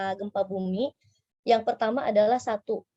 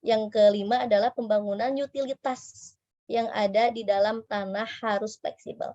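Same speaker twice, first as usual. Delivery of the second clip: usual speaking voice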